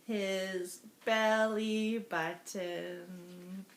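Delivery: slow reveal. Acted. Reveals the funny part.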